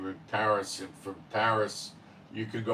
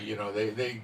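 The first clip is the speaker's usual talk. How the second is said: usual speaking voice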